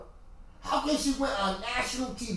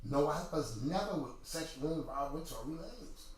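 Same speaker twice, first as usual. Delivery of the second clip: mock straight face; 4 semitones lower, 9 dB quieter than usual. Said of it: "with a straight face".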